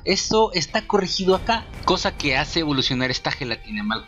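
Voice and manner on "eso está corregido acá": ordinary talk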